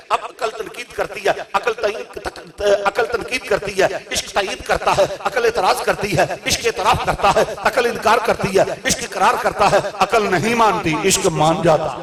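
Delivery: loud impassioned sermon voice; fiery preaching. Acted. Preacher rousing the gathering on love and reason, fiery. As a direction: drive the words at the congregation.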